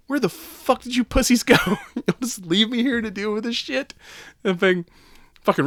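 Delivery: hammed-up betrayed yelling; mock outraged Santa. Santa scolding the reindeer who ditched him, hammed-up outrage.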